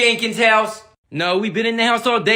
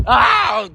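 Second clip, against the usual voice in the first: pained yelp; 5 dB louder than usual. Acted. Ball hits face.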